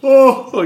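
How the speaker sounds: groan. Real, not acted. Tired after screaming vocals.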